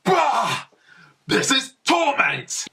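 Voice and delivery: high, anguished, hammed-up cry of torment; sleepy friend jolted awake by the heat, crying out in mock torment; mock anguished wail. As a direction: wail like you're being roasted alive. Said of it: "James woke up ... and was like".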